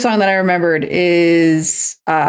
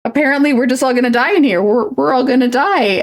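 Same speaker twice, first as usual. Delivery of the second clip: higher, faster mock panic; a little girl sure she will die in class; panicked child; 6 semitones higher than usual. Retold to viewers, child panicking about dying in a fire. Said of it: "my little banshee brain is like".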